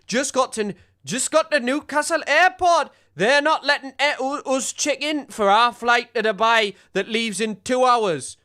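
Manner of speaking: hammed-up Geordie voice for the tweet; Newcastle accent. Streamer reads a stranded Brit's tweet in a mock Newcastle accent. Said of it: "It wasn't a great Newcastle accent at first".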